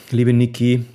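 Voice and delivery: ordinary talk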